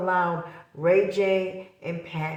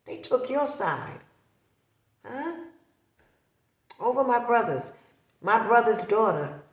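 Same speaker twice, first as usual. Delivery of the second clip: higher, fast, indignant jab; heated accusation; 5 semitones higher than usual. Real, not acted. Confronting Pat over taking her brother's daughter, indignant and heated.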